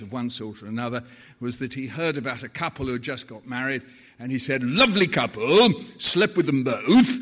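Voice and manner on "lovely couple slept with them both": Sir Morris Bower impression. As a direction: land it like a dry old raconteur. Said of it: "so that was, Sir Morris Bower".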